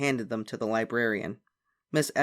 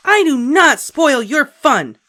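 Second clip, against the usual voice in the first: slow, loud, high angry denial; stomping; 13 semitones higher, 15 dB louder, slower than usual. A narrator's reading. Ramona fighting her sister, slow and loud. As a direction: stamp out every word. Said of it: "stomped Ramona".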